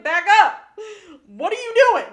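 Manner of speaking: loud, high startled shout; mock alarmed yell. Imagining the cat reacting to him staring, loud startled yell. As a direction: shriek it like a freaked-out cat. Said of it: "I would've been like".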